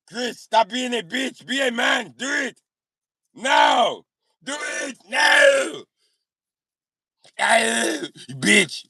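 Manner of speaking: put-on high, drawn-out Austrian-accented yell; hammed-up Austrian action-hero shouting; Arnold Schwarzenegger accent. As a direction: holler orders as a worked-up Schwarzenegger, Austrian accent. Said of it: "doing an Arnold Schwarzenegger accent".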